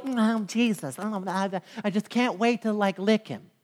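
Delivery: soft, mushy mock devotion; hushed, wishy-washy mock adoration; namby-pamby mimicry. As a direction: coo it feebly, mocking sappy worship. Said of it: "it's this mamby-pamby little".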